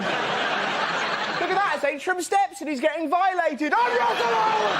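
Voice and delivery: hammed-up high shriek; mock shrieking crowd. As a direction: shriek it like a gawping fan. Onlookers then him shrieking.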